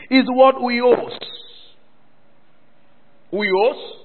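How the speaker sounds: loud, high, slow pulpit teaching; emphatic preaching. Real, not acted. Preacher teaching congregation the Greek word, forceful slow preaching. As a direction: spell it out forcefully for a full church.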